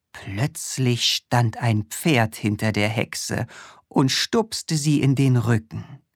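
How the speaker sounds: ordinary delivery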